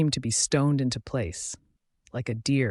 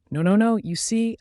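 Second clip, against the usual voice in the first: higher-pitched, mildly panicked denial; 8 semitones higher, 3 dB louder than usual. A narrator's reading. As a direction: hurry to deny it, pitch jumping up. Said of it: "I shook my head at him in panic".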